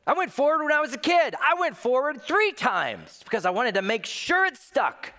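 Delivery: hammed-up church kid bragging; mock churchgoer. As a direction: boast like a proud churchgoer. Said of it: "Don't say to yourself".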